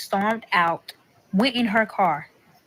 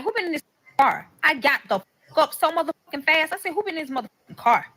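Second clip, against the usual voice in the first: retold confrontation, higher and quick; indignant demand; 6 semitones higher, faster than usual. Woman questioning her partner about his car, indignant. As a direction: fire the question off, pitched up and accusing.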